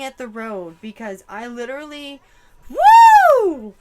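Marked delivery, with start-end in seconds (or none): 2.7-3.7 sudden piercing high whoop. Real, not acted. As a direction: let out a startled shriek of woo. Shocked at the vision.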